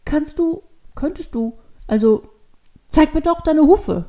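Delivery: falter, then blurt out the request; hesitant child. A narrator's reading. Devil girl asks to see hooves, halting then blurting.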